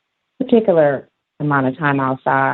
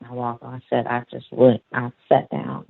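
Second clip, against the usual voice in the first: ordinary talk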